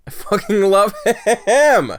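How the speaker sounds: loud, slow, laughing adoration; laughing gush. Real, not acted. Gushing over cute character.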